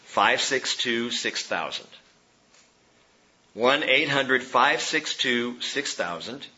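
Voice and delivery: "five six two six thousand" read slowly, digit by digit; slow and clear. Shown as a demonstration. Pastor giving the congregation a phone number, slow and clear. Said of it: "All you have to do is call".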